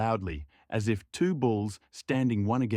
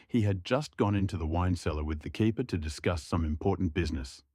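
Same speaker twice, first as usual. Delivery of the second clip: ordinary talk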